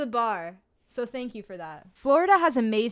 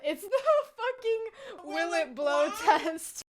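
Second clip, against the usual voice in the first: high, slow laughing wheeze; helpless high-pitched laughter; cracking up; 7 semitones higher, slower than usual. Real, not acted. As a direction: squeeze the words out through real laughter. Cracking up at own comparison.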